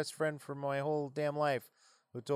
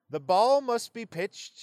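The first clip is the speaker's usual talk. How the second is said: higher, slow proclamation; old-timey rule crier; 6 semitones higher, 4 dB louder, slower than usual. Shown as a demonstration. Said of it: "you almost need to read it in, like".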